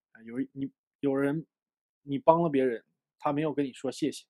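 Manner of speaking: ordinary talk, nothing marked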